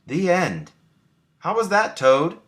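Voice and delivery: high, eager frog asking; Frog's voice. A narrator's reading. Frog asks sleepy Toad about his story, high and eager. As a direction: lift it up high, hopeful Frog. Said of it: "said Frog".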